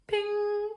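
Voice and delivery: very high sung ping; a bright idea sound. Acted. Mother getting an idea, high ping.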